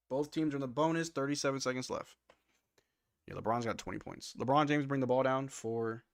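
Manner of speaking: brisk live game call; play-by-play. Acted. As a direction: call the action as it happens.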